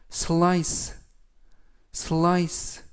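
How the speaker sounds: slice with a clear SL and a long I, SLYSS; repeat-after-me slice; model pronunciation. Shown as a demonstration. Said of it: "slice라고 발음하시면".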